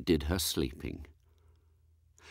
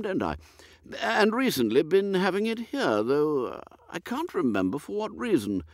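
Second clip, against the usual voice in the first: put-on high, dithering old lord, higher and fluttery; a woolly, absent-minded aristocrat, higher pitched; vague old earl; 8 semitones higher than usual. Lord puzzling over dinner with his butler, high and dithery.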